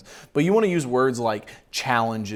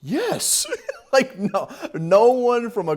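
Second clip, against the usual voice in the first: laughing; 11 semitones higher, slower than usual. Real, not acted. Laughing at the script.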